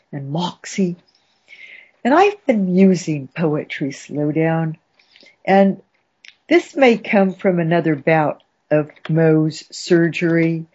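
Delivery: ordinary delivery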